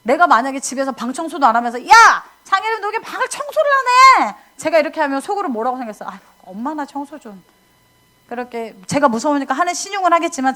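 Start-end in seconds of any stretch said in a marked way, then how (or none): 1.9-4.3 shrill angry shout, much higher and louder; yelling mother. Retold or imitated. Mother yelling at child over uncleaned room, angry shout. 6.1-7.4 soft sulky under-breath grumble; grumbling child thought. Retold or imitated. Child thinking back at nagging mother, soft grumble.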